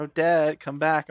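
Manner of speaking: high, loud call. Pretend. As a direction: yell after her to return. Calling after character.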